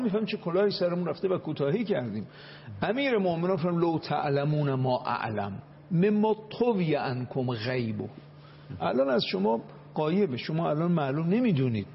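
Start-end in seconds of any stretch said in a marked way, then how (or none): 3.8-8.1 slow, intoned Arabic recitation; recited Arabic hadith. Acted. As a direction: intone the saying slowly and solemnly. Quoting Imam's Arabic words. (قرائت آرام و آهنگین حدیث عربی)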